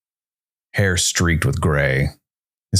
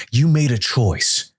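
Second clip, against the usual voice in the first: higher, slow, seething anger; rage; 8 semitones higher than usual. A narrator's reading. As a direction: let the old rage pour out slowly. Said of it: "Calder's rage had clearly never cooled".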